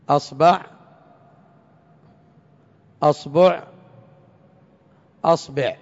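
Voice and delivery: "أصبع" with the first vowel a, then u, then i; three vowels on hamza. Shown as a demonstration. Teacher to class, demonstrating the three vowels on the hamza of "أصبع". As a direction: cycle the hamza of "أصبع" through fatha, damma, kasra. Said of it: "بتثليث الهمزة".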